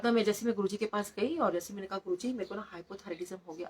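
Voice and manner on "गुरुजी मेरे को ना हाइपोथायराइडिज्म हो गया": soft, subdued retelling of a weak voice; voice barely there. Retold or imitated. Patient telling Guruji her diagnosis, weak-voiced. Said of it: "आवाज़ तो निकल नहीं रही थी".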